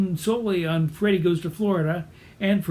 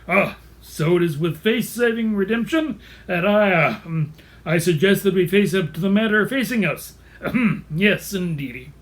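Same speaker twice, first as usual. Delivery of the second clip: blustering pseudo-intellectual with throat-clearing; Charles the Rooster; 3 dB louder than usual. Acted. Rooster on the witness stand, blustery wizard-like voice. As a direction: bluster like the Wizard of Oz, fumbling for words. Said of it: "Charles sounds like the wizard in The Wizard of Oz".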